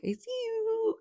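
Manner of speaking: shocked, high-pitched "I see you"; startled squeal. Retold or imitated. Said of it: "And at first I was like".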